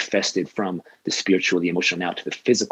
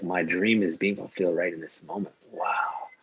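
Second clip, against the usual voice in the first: softer, awed, almost breathless; quiet wonder at a dream fulfilled; hushed awe; 7 dB quieter than usual. Retold or imitated. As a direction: murmur it like a dream coming true, ending in a whispered wow. Retelling awed moment of gratitude.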